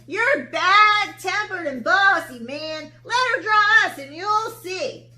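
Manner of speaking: high, loud and cheeky; scolding animals. Acted. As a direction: tell off the bossy lion.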